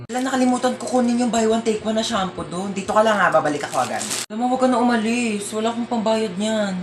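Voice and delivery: put-on soft hushed talk to the child in line; quiet aside. Mother murmuring to kid in queue. (mahinang bulong sa anak habang nakapila)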